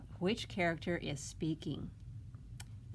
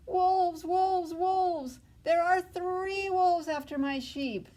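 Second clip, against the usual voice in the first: high, loud, panicked shouting; frantic yell; 12 semitones higher, 8 dB louder, slower than usual. Acted. Shepherd boy yelling to town for help, frantic shout. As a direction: scream for help as the wolves chase the sheep. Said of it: "this time yelling".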